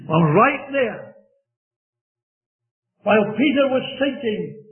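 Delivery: ordinary talk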